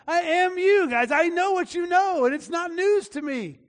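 high-pitched, insistent protest; exasperated retort. Acted. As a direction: tell off friends who lecture the obvious. Acted Job retorting to friends.

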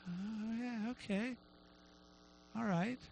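very soft, hesitant little reply; meek acceptance. Retold or imitated. Woman taking in God's answer, softly. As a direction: answer small and quiet, with pauses.

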